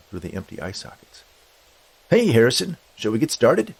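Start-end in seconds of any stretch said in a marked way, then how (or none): 2.1-3.7 high, loud breezy greeting; skeleton spirit. Acted. Skeleton greets Harrison to get started, loud and breezy. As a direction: hail him briskly, pitched up.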